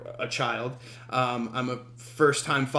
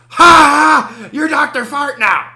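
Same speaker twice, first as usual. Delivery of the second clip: much higher and much louder, a wild triumphant shout; mock crazed yell; 12 semitones higher, 14 dB louder, slower than usual. Stranger slamming a lampshade on the meter, crazed shout. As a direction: bellow it like a man gone unhinged. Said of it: "and goes".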